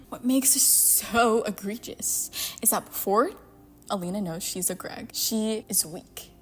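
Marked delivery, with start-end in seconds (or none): none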